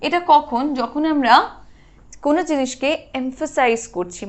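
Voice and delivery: usual speaking voice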